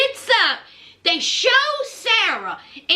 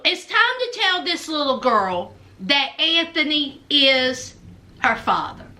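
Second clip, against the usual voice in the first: normal talking voice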